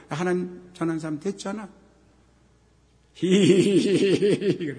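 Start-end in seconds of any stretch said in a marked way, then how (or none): 3.1-4.8 drawn-out, slow imitated snicker; a cheeky hee-hee-hee giggle; mock giggle. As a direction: mimic the child's teasing giggle. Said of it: "야, 야, 그만 웃어".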